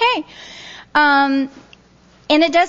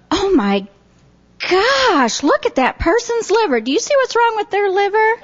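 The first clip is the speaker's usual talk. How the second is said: high-pitched retold astonishment; gasping "oh my gosh" reaction, acted out; startled exclamation; 8 semitones higher than usual. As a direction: reenact a shocked, urgent discovery with a high lift. Reenacting her startled discovery to mom.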